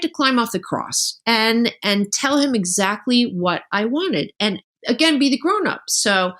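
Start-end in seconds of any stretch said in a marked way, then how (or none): none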